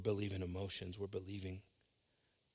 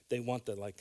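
usual speaking voice

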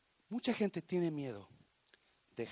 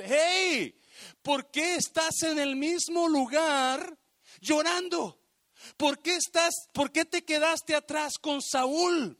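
raised-voice, insistent questioning; God's urgent rebuke; 7 semitones higher, 5 dB louder than usual. Acted. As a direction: preach it as God calling out a mourner. God calls out to Samuel.

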